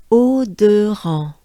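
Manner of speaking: "Eau de ran" projected in careful French; pronunciation demo. Shown as a demonstration. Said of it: "let's try it together".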